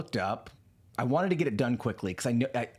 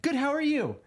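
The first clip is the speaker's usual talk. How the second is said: polite high reply; 13 semitones higher than usual. Retold or imitated. Answering the AI politely.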